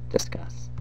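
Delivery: a dry one-word whisper; whispered punchline. Real, not acted. Vlogger whispering a dry challenge to viewers. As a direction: drop it softly like a mic drop.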